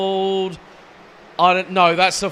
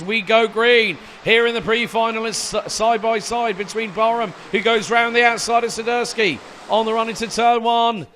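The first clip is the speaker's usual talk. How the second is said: excited, raised race play-by-play; motorsport commentator; 3 semitones higher, 3 dB louder than usual. Acted. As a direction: call the race live and keen. Live race play-by-play, pre-final start.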